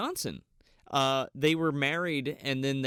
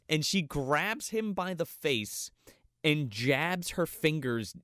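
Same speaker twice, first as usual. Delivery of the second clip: ordinary talk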